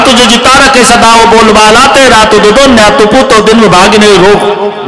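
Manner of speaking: high chanting beggar's plea; mendicant's begging call. Acted. Ravana as monk begs Sita for food, high chanted call. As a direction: intone blessings on one high note, stretching the endings. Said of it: "रावणानं आवाज दिला".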